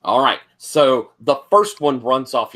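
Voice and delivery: ordinary talk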